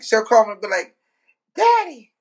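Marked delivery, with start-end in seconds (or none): 1.6-2.1 high-pitched put-on friend voice; mock high-voiced phone greeting; ignorant friend. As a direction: call out high, sliding down at the end. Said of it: "but she ignorant".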